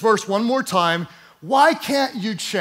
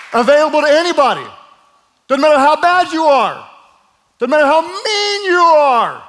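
louder and higher, building with passion; full-force preaching; 6 semitones higher, 4 dB louder than usual. Real, not acted. Preacher crying out to all listeners, full-force preaching. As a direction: preach it out big.

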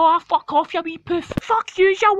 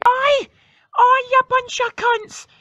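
higher, louder call; shouted oi; 4 semitones higher, 3 dB louder than usual. Acted. Kid yelling at the boys who took his ball. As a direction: yell after them to get their attention. Calling after ball thieves.

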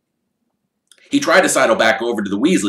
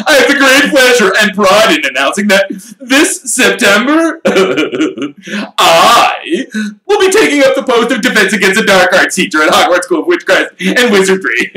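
showman voice; 13 semitones higher, 10 dB louder than usual. Acted. Lockhart's big announcement.

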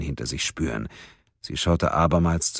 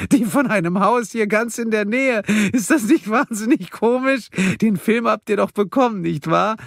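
gleeful giggle; 5 dB louder than usual. Acted. Karen gleeful about the bomb.

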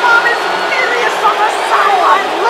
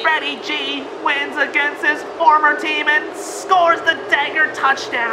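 sportscaster winding down to a sign-off; formal commentary recap; broadcast announcer; 5 semitones lower, 4 dB quieter than usual. Acted. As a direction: wrap up the game, settling into a lower register.

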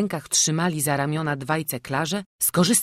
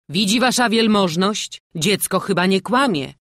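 loud, higher, vindicated call; triumphant; 5 semitones higher, 7 dB louder than usual. A narrator's reading. The stranger appeals to the burmistrz, triumphantly. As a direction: call it out as proof of innocence. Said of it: "triumfująco zawołał obcy".

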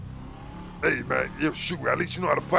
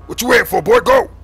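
raised, loud impatient push; urgent order; 6 semitones higher, 9 dB louder than usual. Acted. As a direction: send the kid off sharply. Leader urges the kid to go.

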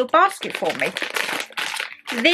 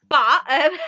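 giggle; 5 semitones higher than usual. Real, not acted. Laughs at own joke.

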